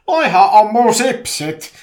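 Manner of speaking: very loud, high, drawn-out yell; huuto. A narrator's reading. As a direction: shout in outrage over your snacks. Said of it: "Petri huusi murtautuneena". (kova, venytetty närkästynyt huuto)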